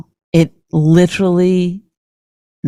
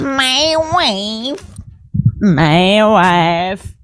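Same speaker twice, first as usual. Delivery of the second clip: drawn-out put-on imitation; exaggerated husband impression; mocking husband voice; 4 semitones higher, much slower than usual. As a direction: stretch each word in a teasing parody. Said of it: "there goes the vo-, voice".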